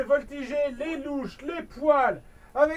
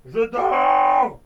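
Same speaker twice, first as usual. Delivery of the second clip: irritated shout; 9 dB louder than usual. Acted. Octopus disturbed while sleeping.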